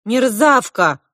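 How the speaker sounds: loud, high insult; offended outburst. A narrator's reading. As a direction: burst out offended at your sister. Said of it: "обиделась Регги".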